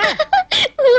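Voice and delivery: high-pitched giggle; high little laugh. Acted. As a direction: burst out laughing briefly. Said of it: "ఏమిటా నవ్వు?".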